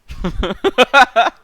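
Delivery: loud, high burst of laughter. Real, not acted. Reacting to co-host's Twin Peaks joke, loud laughter.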